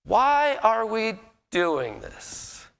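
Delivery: drawn-out wondering why; bewildered question. Retold or imitated. Someone stopping to question.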